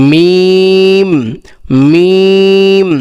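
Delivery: মিম held long from closed lips; letter demo. Shown as a demonstration. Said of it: "যেমন কি?".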